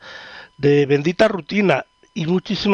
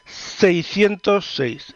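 radio host intro; 4 semitones higher, much slower than usual. Acted. Radio host opening greeting.

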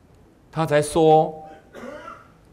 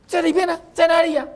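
high-pitched peering voice; 4 dB louder than usual. Acted.

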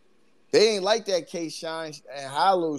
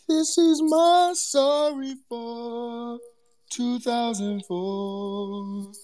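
mock solemn apology; 9 semitones higher, much slower than usual. Mock formal apology to chat.

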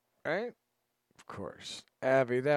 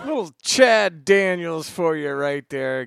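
radio host hype; 8 semitones higher, 15 dB louder, slower than usual. Acted. Radio host hypes the act.

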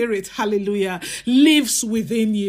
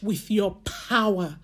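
earnest, pleading prayer voice; preacher praying aloud; fervent prayer; 2 semitones higher than usual. Acted. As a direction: pray it out with urgency.

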